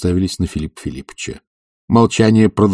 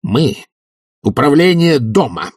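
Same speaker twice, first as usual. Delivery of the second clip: hateful; 8 semitones higher, 3 dB louder than usual. A narrator's reading. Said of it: "с ненавистью заговорил Швондер".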